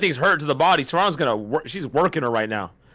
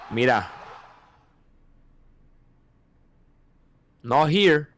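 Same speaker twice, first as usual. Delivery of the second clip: put-on Puerto Rican accent; 3 semitones higher, slower than usual. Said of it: "That was my Puerto Rican accent".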